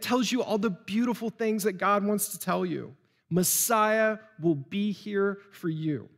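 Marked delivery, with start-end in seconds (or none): none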